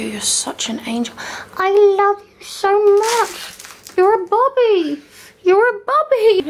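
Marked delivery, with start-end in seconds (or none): 1.6-6.5 much higher, slow cooing voice; talking to the dog Bobby, high slow coo. Real, not acted. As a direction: coo at the puppy.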